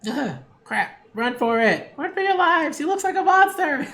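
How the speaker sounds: high, hammed-up panicked yelp breaking into a laugh; mock panic. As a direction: play at fleeing in terror, laughing.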